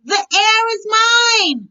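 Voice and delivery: high, loud scream on mine. Acted. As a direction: shriek it while leaping. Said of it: "screamed Lydia".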